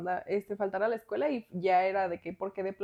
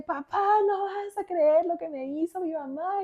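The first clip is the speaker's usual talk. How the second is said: higher, slow tattling whine; whiny complaint; 8 semitones higher than usual. Retold or imitated. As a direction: complain to dad like a wronged kid. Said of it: "le marco a mi papá". (queja lloriqueando, aguda y lenta)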